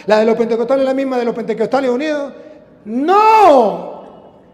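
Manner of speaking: quick list building to ringing cries; fervent preaching. Acted. Preacher challenging rival sects' faith, fervent preaching. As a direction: rattle off the list, then proclaim loudly.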